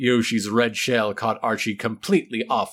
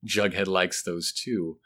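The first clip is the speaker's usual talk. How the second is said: ordinary delivery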